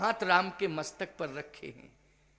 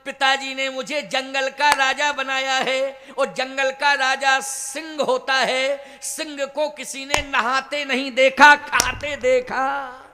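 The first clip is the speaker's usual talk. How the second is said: loud, raised storyteller's declamation; Rama's declaration; 4 semitones higher, 8 dB louder than usual. Retold or imitated. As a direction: proclaim it to the crowd as Rama. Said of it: "तो राम जी बोले". (राम की ऊँची आवाज़ में घोषणा)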